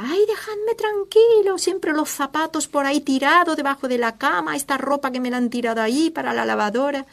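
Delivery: high shrieking exasperated wife; mock frazzled shriek. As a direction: shriek it out like a fed-up mother. Que grita.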